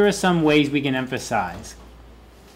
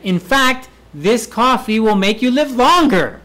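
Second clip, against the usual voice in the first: hammed-up emphasis; 7 semitones higher, 4 dB louder than usual. Shown as a demonstration. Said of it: "emphasis demo, overacted".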